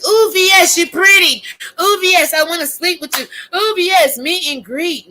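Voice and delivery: high, loud swooning over a star; hammed-up adoring fangirl; mock fan gushing. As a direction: squeal it like a starstruck fan, mockingly. Mocks gushing fans throwing money.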